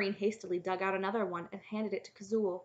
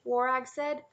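ordinary delivery

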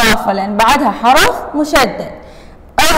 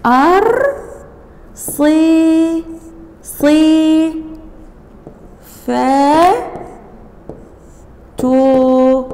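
slow, spelled out in separate syllables; 3 semitones higher, much slower than usual. Shown as a demonstration. Teacher spelling out a hard word for dictation. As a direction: break أرصفتها into syllables, one at a time. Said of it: "اكتبها شوية شوي".

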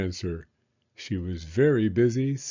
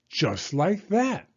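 dramatic drawl; 8 semitones higher, slower than usual. A narrator's reading. Spider catches fly, dramatic beat.

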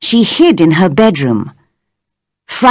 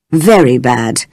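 lower, grave confirmation; serious; 7 semitones lower than usual. A narrator's reading. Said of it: "he answered seriously".

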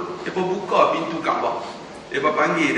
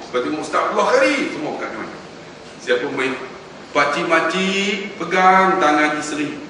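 normal talking voice

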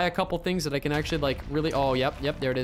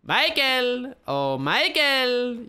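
drawn-out, higher sing-out of the name; taunting call-out; 4 semitones higher, much slower than usual. Real, not acted. Calls out taunting to opponent.

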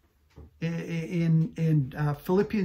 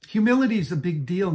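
normal talking voice

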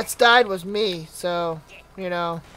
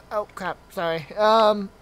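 usual speaking voice

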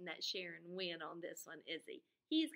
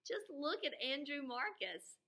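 put-on higher, tattling little girl; giggly schoolgirl; 7 semitones higher than usual. Lisa tattling on Andrew to the teacher, higher and giggly. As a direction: tell on him with a giggle. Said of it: "Lisa stood up".

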